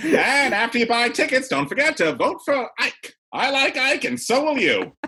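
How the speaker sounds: high, loud fifties announcer; vintage campaign ad. Pretend. Spoofing an old radio ad for Ike, high and loud. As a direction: pitch it like an Ike ad.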